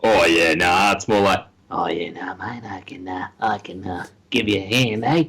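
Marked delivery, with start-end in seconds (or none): 0.0-0.9 low, drawn Aussie voice; mock Australian accent, pitched down; crusty Australian. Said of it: "crusty, so like". 1.7-5.2 put-on creepy Australian accent, hushed and low; crusty Australian. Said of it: "crusty, so like".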